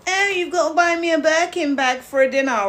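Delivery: high, loud, put-on voice of a demanding Instagram girl; demanding Instagram model. Mocking the Instagram girls she refuses to be, in a high, loud put-on voice. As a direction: play the spoiled girl who expects a Birkin bag. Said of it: "aren't they them kind of girls".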